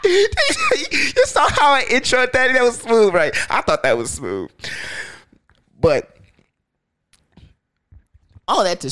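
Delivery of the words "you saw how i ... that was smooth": laughing. Real, not acted. Laughing at his own intro.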